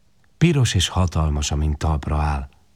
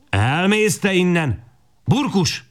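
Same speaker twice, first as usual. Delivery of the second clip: high, slow scolding shout of a harsh woman; angry woman; 11 semitones higher, slower than usual. A narrator's reading. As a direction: drive them off the doorstep. Woman chases boys off. (dühös asszony elzavarja a fiúkat)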